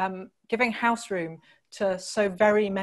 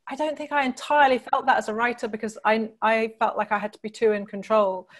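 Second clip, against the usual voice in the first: ordinary delivery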